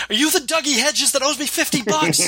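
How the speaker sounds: high mock yell down the phone; imitating Coach yelling. Retelling Coach yelling at the caller, high mock yell. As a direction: yell it high like an angry old man on the phone. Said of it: "starts yelling at this guy across the phone".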